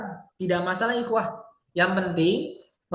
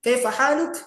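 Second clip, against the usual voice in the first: "Kaifa haluk" pitched up with a rising haluk; intonasi dimainkan; 4 semitones higher than usual. Shown as a demonstration. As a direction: ask "Kaifa haluk" high, lifting the last word. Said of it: "yang intonasi dimainkan gitu". ("Kaifa haluk" bernada tinggi, naik di akhir)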